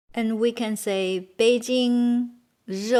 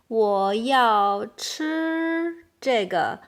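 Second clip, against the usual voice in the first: long, separated syllables; slow model sentence; 3 dB louder, much slower than usual. Shown as a demonstration. Teacher showing how to order food, very slowly. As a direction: spell out the order slowly, word by word.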